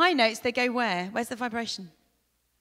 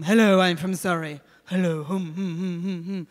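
posh Surrey accent, louder, with humming; Surrey accent demo, loud; 2 semitones lower, 12 dB louder than usual. Shown as a demonstration. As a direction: put on the Surrey voice and hum it. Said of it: "think of different accents".

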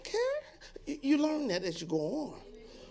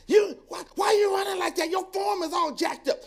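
high, loud jeering yell at the runner; mock heckler shout; 12 semitones higher, 9 dB louder than usual.

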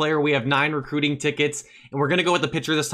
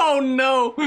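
nervous laugh; 10 semitones higher, 3 dB louder than usual. Real, not acted. Knuckleball pitch makes him nervous.

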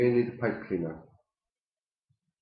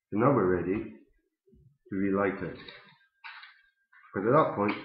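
talking around pipe stem; pipe in mouth; 4 dB louder than usual. Real, not acted. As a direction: lips clenched on pipe. Seen 0: Pipe stem held between lips throughout labelled words.

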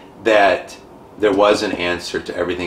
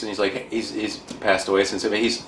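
ordinary talk

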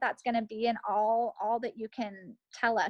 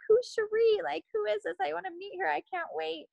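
retelling her own thrilled first reaction, pitched up high and excited; eager excitement; 8 semitones higher than usual. Retold or imitated. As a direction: squeal it out like you can't wait to meet her.

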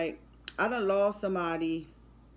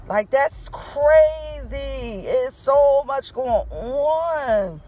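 very slow, louder, words stretched out in shock; overwhelmed grief; 5 semitones higher, 6 dB louder, much slower than usual. Real, not acted. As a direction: let the shock stretch every word. Overwhelmed by friend's death.

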